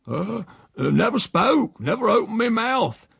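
high-pitched rustic denial; protesting old uncle. A narrator's reading. Uncle denies insulting the housekeeper, protesting.

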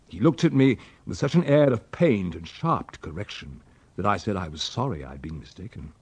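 plain reading voice

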